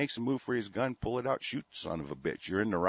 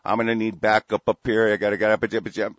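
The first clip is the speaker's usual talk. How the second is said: loud, urgent mock call on the radio; mock radio call for backup; 8 dB louder than usual. Host imitates the officer radioing for backup, loud and urgent. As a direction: yell for backup like a panicky officer. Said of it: "pick up that fucking microphone and go".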